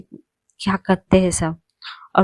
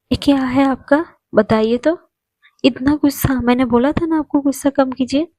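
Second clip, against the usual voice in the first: high-pitched, pleading scold; Annie's high scolding voice; 6 semitones higher than usual. Acted. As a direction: raise the voice and chide her. (ऊँची आवाज़ में डाँटती एनी)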